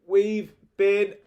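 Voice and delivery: high, loud, strangled outcry; spluttering in disgust; gagging, spluttering. A narrator's reading. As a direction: cry out while gagging. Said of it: "gagging and spluttering".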